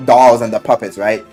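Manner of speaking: usual speaking voice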